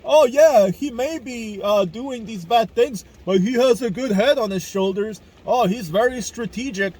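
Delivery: a put-on, high, mocking voice of Putin's fans; mocking admirer voice. Imitating people who call Putin strategic, in a sneering mock voice. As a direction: sneer their praise back at them.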